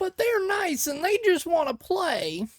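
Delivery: high, slow cutesy voice; high, slow sugary excuse; mock sweet defence. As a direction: plead like a doting pet owner. Mock defence of cute demons.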